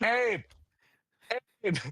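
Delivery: high-pitched pestering call; obnoxious calling out. Acted. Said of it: "do the obnoxious thing".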